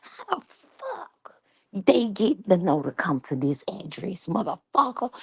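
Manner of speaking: mock outraged mom, pitch swinging high; mom cussing out Tim. Mom demanding how they found the address, mock outrage. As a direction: cuss him out like a furious mother. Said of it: "And she was like".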